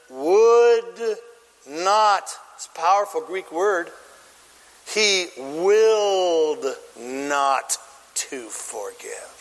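"would" and "not" set apart by pauses; slow right down on "willed not to forgive", dropping away on "forgive"; emphatic stress. Shown as a demonstration. Preacher stresses the Greek word.

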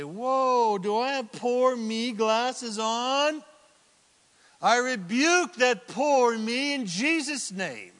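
loud, slow self-rebuke; preacher shows how to rebuke poor me; firm rebuke. Acted. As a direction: call yourself out loud. Catching oneself and rebuking.

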